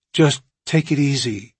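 ordinary delivery, nothing marked

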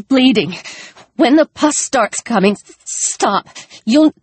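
high, panting and stammering. Acted. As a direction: push words out between pants. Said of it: "he panted".

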